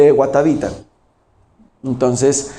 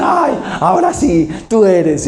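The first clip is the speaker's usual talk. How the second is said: tono burlón. Retold or imitated. Burla de los discípulos arrodillados.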